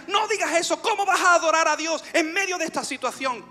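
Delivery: high, indignant challenge; inner protest. Retold or imitated. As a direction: object urgently to the very idea. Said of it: "Algo de mí me decía". (protesta interior aguda e indignada)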